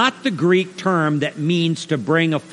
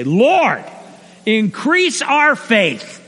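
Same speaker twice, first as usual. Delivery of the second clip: drawn-out, pitched-up plea to heaven; exasperated plea; 5 semitones higher, much slower than usual. Acted. Apostles appeal to the Lord, exasperated. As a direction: cry out, overwhelmed by the demand. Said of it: "throw their arms up in the air".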